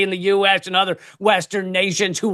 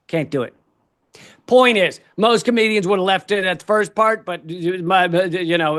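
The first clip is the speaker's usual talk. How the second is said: usual speaking voice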